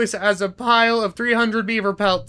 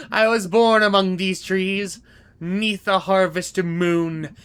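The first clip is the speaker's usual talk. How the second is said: ordinary delivery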